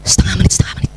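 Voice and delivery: breathy whisper to yourself; whispered self-reminder. Acted. Mother reminding herself of the time limit mid-scolding, whispered.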